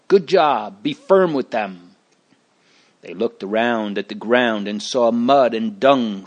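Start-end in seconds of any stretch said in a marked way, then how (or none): 0.1-1.9 mild snarl, pitched up at the start; a touch of a snarl in the voice. A narrator's reading. Onlookers egging on the men beating prisoners, mildly snarling. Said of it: "Others snarled".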